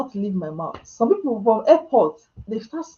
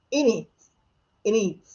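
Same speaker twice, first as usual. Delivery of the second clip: mock British innit. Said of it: "those British".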